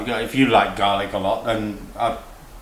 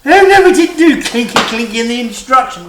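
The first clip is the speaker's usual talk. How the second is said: hammed-up posh excitement; 10 semitones higher, 8 dB louder than usual. Hammed-up posh cooking host.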